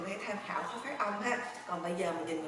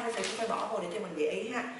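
ordinary talk, nothing marked

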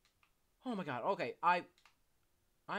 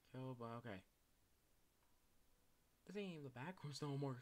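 usual speaking voice

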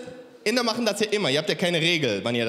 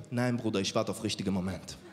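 low, softer imitated refusal; subdued reply; 9 semitones lower, 6 dB quieter than usual. Comedian voicing friend refusing to approach woman, subdued reply. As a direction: drop pitch and volume, lightly played.

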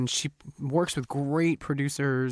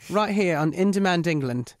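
put-on British accent; 3 semitones higher than usual. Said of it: "in that style".

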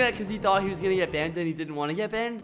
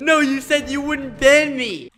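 higher, louder mock complaint; mock protest read from chat; protesting player; 7 semitones higher, 6 dB louder than usual. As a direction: whine as the betrayed player.

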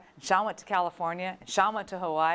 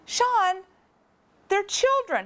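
much higher, exasperated; exasperated plea; 11 semitones higher, 3 dB louder, slower than usual. Retold or imitated. Sister pleading with her brother, high and exasperated. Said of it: "I said".

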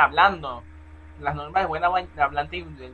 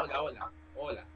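ordinary talk

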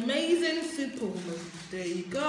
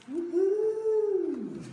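excited whoop; 9 semitones higher, 5 dB louder than usual. Real, not acted. Cheering over her box.

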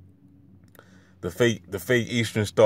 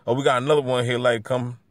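ordinary talk

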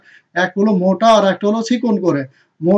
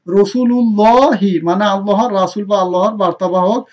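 normal talking voice

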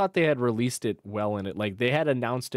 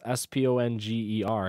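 normal talking voice

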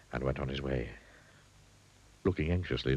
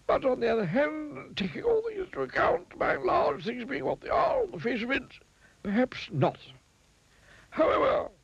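high, rambling wizard voice; a high old wizard who loves his words; wordy wizard. A narrator's reading. Wizard teasing the miser, high and wordy. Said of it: "the wordy wizard".

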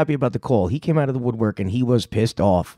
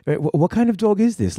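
imitating the tuner; 7 semitones higher than usual. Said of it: "and then he says".

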